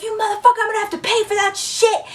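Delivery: high-pitched indignant complaint; mock outrage. Peanut Butter jumps over the couch, mock outrage. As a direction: imitate a drunk roommate's outburst. Retold angry roommate in fight.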